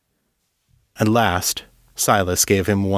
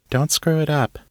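higher, light teasing warning from Callie; mischievous tease; 7 semitones higher than usual. Acted. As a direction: tease him with a glint in the voice. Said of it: "eyes sparkled with mischief".

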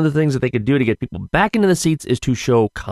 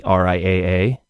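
usual speaking voice

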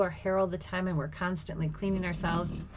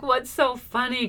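high, loud, drawn-out mock affront; retold indignation; 6 semitones higher, 5 dB louder than usual. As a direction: demand it slowly, acting offended. Retelling horrified reply to laughing nurse.

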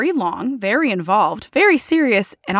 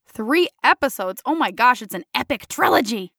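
ordinary talk, nothing marked

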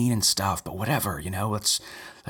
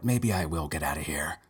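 ordinary delivery

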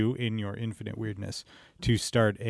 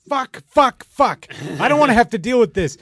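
higher and louder panicked swearing; half-joking panicked yelp, much higher and louder; mock freak-out; 10 semitones higher, 9 dB louder than usual. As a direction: act out a frantic freak-out over a bad comment. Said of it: "I freak out".

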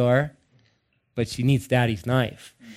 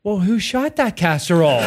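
lightly voiced child, higher pitch; a hint of an earnest little boy; imitated young son; 5 semitones higher than usual. Son asks dad about his dinner, lightly imitated child. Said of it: "he looked up and he said".

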